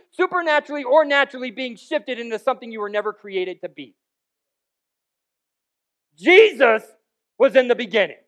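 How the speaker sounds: loud, high, driving sermon voice; full-force preaching. Real, not acted. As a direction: preach it hard, hammering each phrase home.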